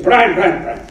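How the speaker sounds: put-on an older actor coaxing a young one, lightly done; a fond hint of Fulton calling across the set; imitating Fulton. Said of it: "he kept saying".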